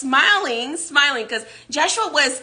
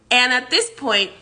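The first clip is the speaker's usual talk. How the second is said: usual speaking voice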